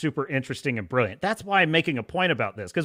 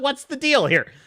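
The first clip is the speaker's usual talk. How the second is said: usual speaking voice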